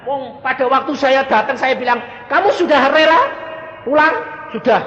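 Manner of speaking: high and loud, insistent questioning; emphatic retelling. Retold or imitated. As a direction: press her on being ready. Retold exchange with the mother. (bercerita dengan suara tinggi dan keras)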